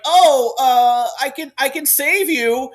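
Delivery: high, loud, drawn-out offer to help; hammed-up cry to the rescue; acted exclamation. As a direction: call out a dramatic rescue, stretching the words. Voicing a panicked rescuer in retelling.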